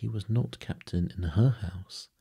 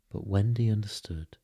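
ordinary delivery

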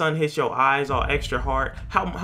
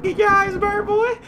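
much higher, squealing taunt; high mocking taunt; 6 dB louder than usual. Gamer taunting the rat with the flashlight, high squeal.

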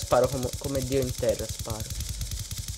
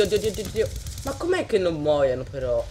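usual speaking voice

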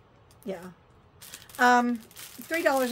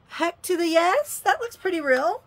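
higher, louder delighted approval; pleased excitement; 6 semitones higher, 5 dB louder than usual. Real, not acted. Vlogger seeing her fake nose ring on, pleasantly excited. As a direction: cheer the result with a lifted voice.

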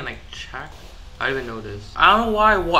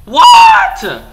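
very high loud stretched what; shocked what; 14 dB louder than usual. Real, not acted. Gamer stunned by what happens, shrieking. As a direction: cry out in disbelief.

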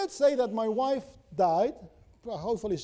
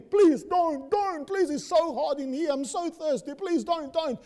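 higher, pleading cry from inside the coffin; mock screaming plea; 8 semitones higher than usual. Wife in the coffin begs the deacons, mock high pleading scream. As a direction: wail it like a trapped woman begging. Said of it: "but she's screaming".

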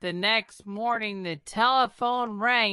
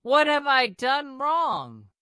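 higher plea, near tears; anguished cry; 7 semitones higher than usual. A narrator's reading. King pleading with Churchill, higher and anguished. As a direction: ask it in despair. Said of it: "he cried".

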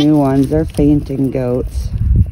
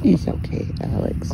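soft whisper; 4 semitones higher, 6 dB quieter than usual. Real, not acted. Whispered soothing.

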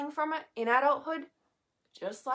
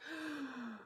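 quiet, drawn-in gasp of recognition; soft gasp of amazement; awed gasp; 3 semitones higher, 13 dB quieter than usual. Retold or imitated. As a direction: gasp softly as if struck by it. Acts out hearing the podcast.

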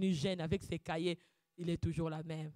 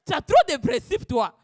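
high, loud teasing reproach; half-acted exasperation; mock complaint; 11 semitones higher, 16 dB louder than usual. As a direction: scold him for his principles. Retold complaint to fiancé.

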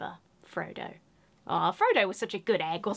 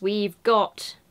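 ordinary talk, nothing marked

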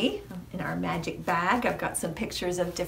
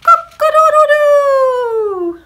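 loud, high, long-held cock-a-doodle-doo; rooster crow; 15 dB louder, slower than usual. A narrator's reading. Rooster waking the farmer at sunrise, crowing loudly. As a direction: crow at full volume like a rooster. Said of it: "the rooster crowed".

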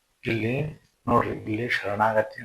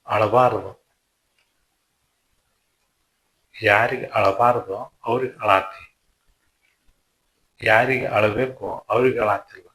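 louder stern rebuke to a weeping pupil; Krishna rebuking Arjuna, loud and stern; Krishna scolding; 5 dB louder than usual. Acted. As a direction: scold him firmly, like a teacher.

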